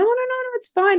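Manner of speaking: very high, breezy reassurance; eager shop lady. Retold or imitated. Spanish shop clerk brushing off the customer's worry, very high and breezy. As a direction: toss it off, sing-song high.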